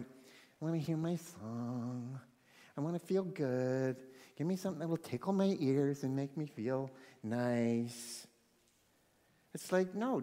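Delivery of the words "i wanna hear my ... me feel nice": soft, dreamy self-indulgence; imitating someone drawing out cosy wishes; mock complacency. Churchgoers just sitting and wanting to feel good, mock complacent.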